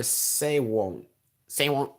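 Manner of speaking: half-hearted mock yell of a classmate; lightly mimicked heckling; terrible impression. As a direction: badly imitate kids calling out a catchphrase. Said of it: "That was a terrible impression".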